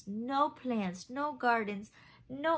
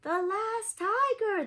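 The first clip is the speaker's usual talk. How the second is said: high, loud announcing shout; crowd shouting; 10 semitones higher, 6 dB louder than usual. Acted. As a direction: call it out like a gawking crowd. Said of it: "the people shouted".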